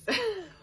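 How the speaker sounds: sudden high laugh; laughing at the thought of mum; burst of laughter. Real, not acted. Retelling the story, she bursts into a high laugh.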